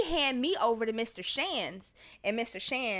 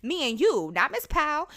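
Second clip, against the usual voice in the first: higher-pitched, upset objection; 6 semitones higher than usual. Retold or imitated. Student to assistant principal about calling coach, upset. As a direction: protest in a raised, indignant pitch. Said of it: "And I was upset".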